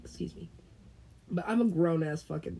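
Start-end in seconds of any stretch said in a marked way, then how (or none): 0.0-0.5 fast, voiceless excuse me; mock whisper. Asking to leave class, a quick whisper. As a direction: rush it out in a whisper. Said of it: "it's like".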